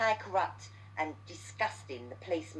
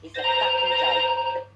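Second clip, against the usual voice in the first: angry outburst; 11 dB louder, slower than usual. Real, not acted. Furious rant about police inaction.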